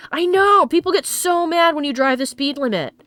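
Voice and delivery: loud frustrated venting; exasperated rant. Real, not acted. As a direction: vent about tailgaters. Ranting about tailgaters.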